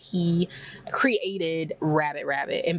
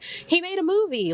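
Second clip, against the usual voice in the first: dismissive shrug; 12 semitones higher than usual. Retold or imitated. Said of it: "I was like".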